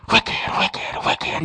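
breathy whispered echo of an ad jingle; voiceless echo after the show's name. Retold or imitated. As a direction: whisper it like a fading commercial tagline. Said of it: "it's catchy".

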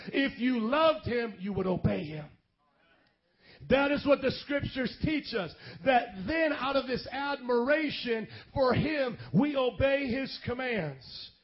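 preaching at full force. Acted. Preacher builds to emphatic point.

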